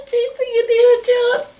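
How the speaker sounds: high, giggling through the words; laughing. Real, not acted. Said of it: "you're still laughing about that".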